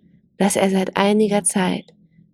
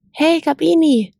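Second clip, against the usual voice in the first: calls higher and louder after her; calling out; 8 semitones higher, 5 dB louder than usual. Acted. Mojo calls after the departing gazelle, louder and higher. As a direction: call out across the distance. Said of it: "rief Mojo".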